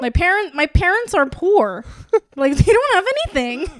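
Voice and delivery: loud, high comic wail; mock pleading. Host who might get sued, loud mock pleading. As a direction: protest poverty with hammed-up desperation.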